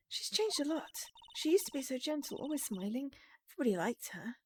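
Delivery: lightly voiced game character. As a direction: lightly voice a younger sister speaking up. Voices Ema talking about her sister.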